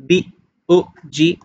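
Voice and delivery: "ডি ও জি" as separate letter names; spelled out. Shown as a demonstration. Teacher showing how a word is not pronounced, spelled out. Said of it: "এভাবে বলবো না".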